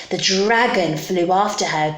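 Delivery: usual speaking voice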